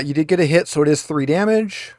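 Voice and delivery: ordinary talk, nothing marked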